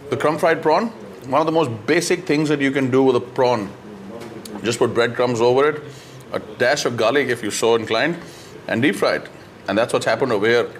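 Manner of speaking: chewing while talking; talking between bites; mouth full. Real, not acted. Seen 0: Green-shirt man holds prawn near mouth, eating while talking; face small.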